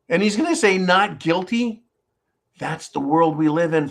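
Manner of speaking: ordinary talk, nothing marked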